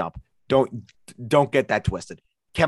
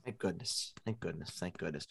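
muttered; 5 semitones lower, 19 dB quieter than usual. Acted. Mock relief, said under breath.

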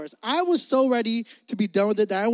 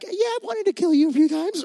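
high, put-on voice of the wife, teasing; voicing the wife; 8 semitones higher than usual. Pastor quotes his wife's teasing confession, high put-on voice. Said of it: "She's like".

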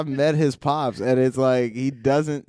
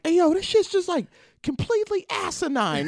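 high, squeaky mock dad; shrill imitation of an annoyed dad; imitating dad. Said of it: "you just heard my dad like".

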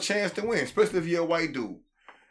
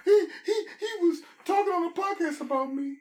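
shocked gasp. Pretend. Mock gasping over being mentioned.

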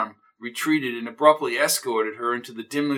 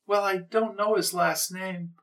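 neighbor's high voice; 6 semitones higher, 4 dB quieter than usual. Acted. Mrs O'Toole.

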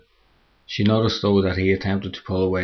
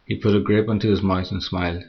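plain reading voice

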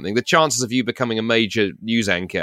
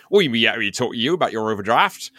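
mock Somerset bank clerk; broad Wiltshire burr, played for laughs; West Country accent. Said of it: "from Somerset or Wiltshire".

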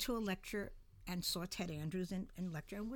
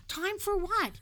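much higher and louder "Time for what?"; exasperated; 12 semitones higher, 9 dB louder, slower than usual. Retold or imitated. Narrator asking the old lady again, louder and exasperated. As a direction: fire the question out, fed up. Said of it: "And I keep on saying".

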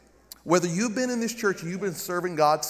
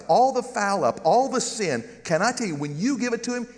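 normal talking voice

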